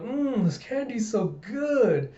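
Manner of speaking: put-on high, drawn-out childlike relish; Anya. Child enjoys candy at papa's workplace, blissful. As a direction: savor it slowly as a delighted little kid. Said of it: "you see Anya going".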